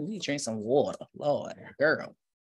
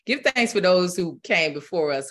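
normal talking voice

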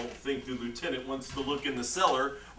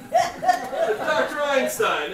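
mock grand call; 10 semitones higher, 5 dB louder, much slower than usual. Nephew calls the fake doctor over.

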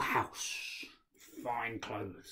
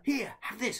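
higher, louder brother voice; brother's voice; 12 semitones higher, 4 dB louder than usual. Acted. Brother giving Ahmed gold, high voice. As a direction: hand it over eagerly.

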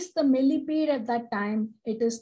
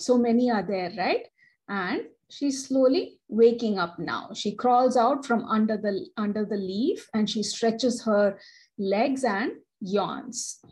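usual speaking voice

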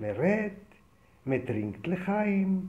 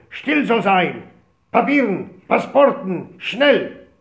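officer's shout; 4 semitones higher, 10 dB louder, much slower than usual. Retold or imitated.

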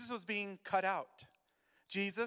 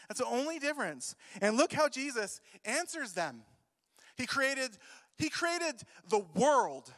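fiery preaching; 3 semitones higher, 6 dB louder than usual. Acted. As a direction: preach it hard to the congregation. Preacher builds to full force.

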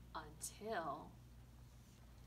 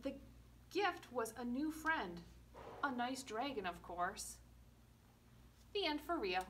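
usual speaking voice

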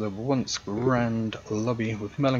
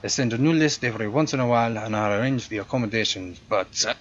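louder, hammed-up French-European accent for a snooty concierge; hotel concierge greeting a guest, in a louder generic European accent; 3 semitones higher, 6 dB louder than usual. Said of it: "my generic European accent".

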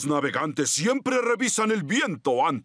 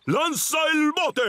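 excited command; 10 semitones higher than usual. Acted. Captain giving an order.

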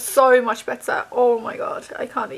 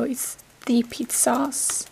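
ordinary talk

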